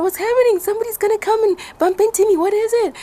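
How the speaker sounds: high, loud panicked questions. Retold or imitated. Girl on ski slope, frightened, asking what's coming. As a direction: gasp out your confusion in fright. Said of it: "I'm so scared".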